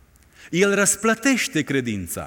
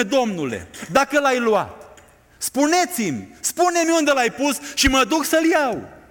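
preaching at full force; 7 semitones higher than usual. Acted. Preacher driving home Mary's longing to the congregation, fervent. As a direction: build the plea with fire.

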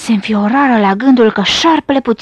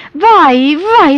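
loud, high wail of woe; snake's pained yelling; crying out; 5 semitones higher, 6 dB louder than usual. A narrator's reading. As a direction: wail it out at full voice. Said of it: "striga șarpele cât îl ținea gura".

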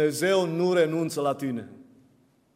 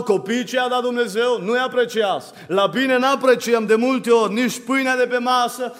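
forceful, insistent pulpit delivery; impassioned preaching; 6 semitones higher, 6 dB louder than usual. Real, not acted. Preacher reproaches people who don't value blessings, impassioned preaching. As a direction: drive each item of the list home.

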